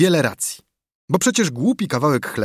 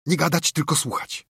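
higher-pitched order to listen; hushed command; 4 semitones higher than usual. A narrator's reading. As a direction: order your friends to stop talking. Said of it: "nakazał Tomek". (nakaz, by słuchać w ciszy)

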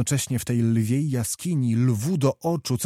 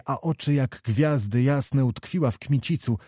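usual speaking voice

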